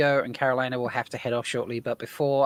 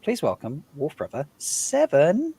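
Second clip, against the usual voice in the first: ordinary talk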